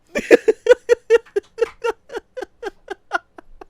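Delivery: high, loud breathless laughter; helpless laughing fit. Real, not acted.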